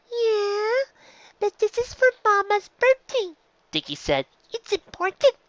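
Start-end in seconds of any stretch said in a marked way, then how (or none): 0.1-3.4 put-on high, small child's voice; a young filly insisting, pitched way up; little filly voice. Dicky answers Scootaloo. 4.5-5.4 put-on high, small child's voice; a young filly insisting, pitched way up; little filly voice. Dicky answers Scootaloo.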